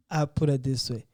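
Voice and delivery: normal talking voice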